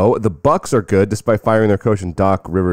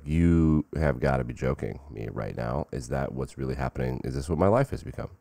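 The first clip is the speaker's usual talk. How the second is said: mock disbelief; 6 semitones lower, 9 dB quieter than usual. Kevin Durant reacting to the fan, soft weary disbelief. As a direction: mutter it like you can't believe it. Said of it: "Kevin Durant was like".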